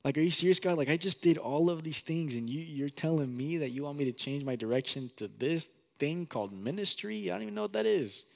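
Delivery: high, disbelieving protest to God; pleading in disbelief, voice climbing high; retelling his shocked sixteen-year-old self arguing with God; incredulous teen self. Retold or imitated. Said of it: "I was like".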